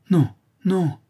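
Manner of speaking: high, loud cry of terror; terrified refusal. A narrator's reading. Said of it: "dit le brigand avec terreur".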